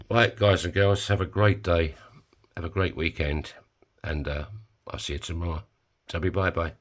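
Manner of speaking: usual speaking voice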